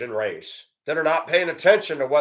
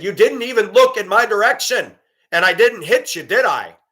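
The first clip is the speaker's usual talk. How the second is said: louder, higher indignant challenge; heated retort; 6 semitones higher, 6 dB louder than usual. Retold or imitated. Driver confronting pedestrian woman, louder indignant challenge. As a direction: throw it back at them hard. Retold confrontation in parking lot.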